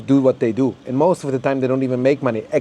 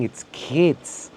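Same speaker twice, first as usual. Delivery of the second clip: usual speaking voice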